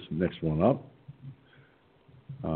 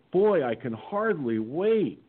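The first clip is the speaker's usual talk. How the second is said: slow, drawn-out mock enthusiasm; sarcastic; 6 semitones higher, slower than usual. Reading his reply to Pelosi, slow and sarcastic. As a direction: drip sarcasm over the words. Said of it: "said sarcastically".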